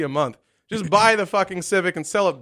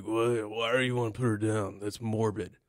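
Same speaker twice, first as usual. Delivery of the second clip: lower, softer mock objection; imitating brother; 6 semitones lower, 9 dB quieter than usual. Brother on the phone objecting to putting the cat down, low mumbling imitation. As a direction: quietly mimic a low-voiced brother.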